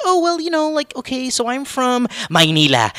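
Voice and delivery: high-pitched speech slipping into Filipino Maynila; sudden Filipino accent. Acted. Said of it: "you just went ethnic on me".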